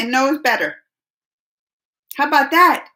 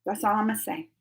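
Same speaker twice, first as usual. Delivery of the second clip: much softer, under the breath; muttered aside; 2 semitones lower, 10 dB quieter, faster than usual. Real, not acted. Streamer to chat, first under the breath, much softer. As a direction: say it half to yourself, much softer.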